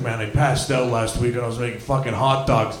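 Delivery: dazed stoner. Retold or imitated. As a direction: mumble it like a burnt-out guy. Friend's dazed explanation.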